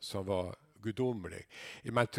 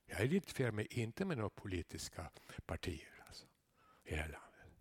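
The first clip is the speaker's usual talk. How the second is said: soft, hushed whisper; whispered aside; 3 semitones lower, 9 dB quieter than usual. Real, not acted. Pastor quietly confessing his politics to the church. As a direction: let it slip out in a whisper.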